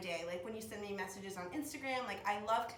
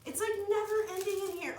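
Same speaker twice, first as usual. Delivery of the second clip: excited high; 11 semitones higher, 5 dB louder than usual. Real, not acted. More items keep appearing.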